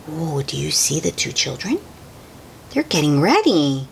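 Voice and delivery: plain reading voice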